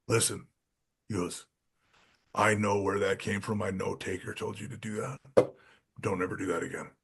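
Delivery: low, soft, firm reprimand; a hushed, lightly voiced stern boss; quiet stern warning. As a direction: say it softly, like a boss laying down the law. Vince scolding quietly, retold.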